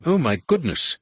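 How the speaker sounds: fussy, high-pitched droid voice; bleating droid. A narrator's reading. Droid bleating in alarm as the ship lurches. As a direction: fret like a prissy protocol droid. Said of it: "C three P O bleated".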